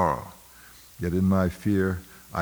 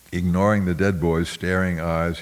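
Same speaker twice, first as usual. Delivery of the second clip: usual speaking voice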